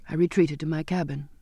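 plain reading voice

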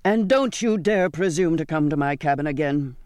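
angry, restrained narrated shout. A narrator's reading. Captain banning the girl from his cabin, shouting. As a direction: shout a furious warning. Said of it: "he shouted".

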